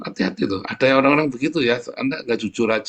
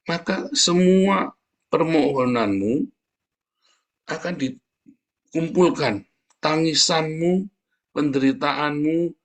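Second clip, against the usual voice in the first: fervent preaching; 4 semitones higher, slower than usual. Acted. Preacher exhorting the congregation, slow and fervent. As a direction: drive the scripture home from the pulpit.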